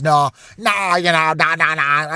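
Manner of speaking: rough Kermit-like impression; half-successful Kermit voice, brushing off a question; Pat Mahomes Kermit voice. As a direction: imitate a quarterback with a Kermit-the-frog voice. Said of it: "can't do the Kermit accent exactly like him".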